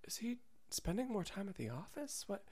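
hushed, high, worried movie wife; breathy soap-opera worry, half whispered; Lifetime movie wife. Retold or imitated. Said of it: "with two hands cupping a cup of tea and looking out the window and thinking".

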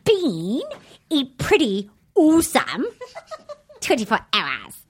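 halting, amateur New Zealand accent; attempted New Zealand accent. Shown as a demonstration. Host tries copying Jordan a second time, attempted Kiwi accent. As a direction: copy a Kiwi phrase in pieces, half laughing. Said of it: "a New Zealand accent with me".

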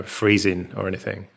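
ordinary talk, nothing marked

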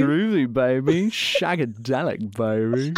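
toothy British swinger voice; hammed-up cheeky British playboy; Austin Powers. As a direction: draw out the catchphrases as a groovy spy. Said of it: "I do a little bit of an Austin Powers".

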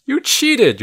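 high-pitched storybook shout; accusing shout. A narrator's reading. Child accusing the cheating wizards, shouting. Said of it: "you shout".

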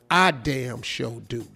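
slow, low knowing aside; parenthetical aside. Shown as a demonstration. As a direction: drop low and draw out the hidden meaning. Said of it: "'cause parentheses".

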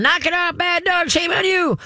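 very high-pitched frantic mock scolding; screaming and yelling. Imitated owner scolding a barking dog, mock screaming and yelling. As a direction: shriek it like a worked-up owner. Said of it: "people start screaming and yelling".

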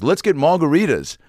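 high, lilting imitation of a rock star. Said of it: "And he goes".

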